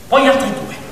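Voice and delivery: normal talking voice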